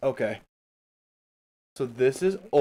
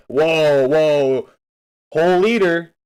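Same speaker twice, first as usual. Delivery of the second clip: loud, higher whoa of fake shock; mock amazement; 5 semitones higher, 7 dB louder, much slower than usual. Real, not acted. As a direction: play up being impressed. Reacting to a viewer's liter of water.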